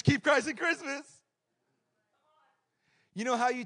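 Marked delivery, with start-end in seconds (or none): 0.0-1.2 loud, high, slow sing-out of a slogan; mock preaching. Preacher mocks those who only preach the slogan, loud mock preaching. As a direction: preach it mockingly, pitched right up. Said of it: "we just wanna preach".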